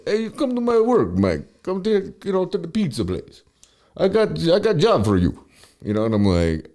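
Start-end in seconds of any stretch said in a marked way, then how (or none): 0.1-5.4 high mock Russian-accented voice; imitating Sergey. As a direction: put on Sergey's broken-English accent, pitched up. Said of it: "He's like".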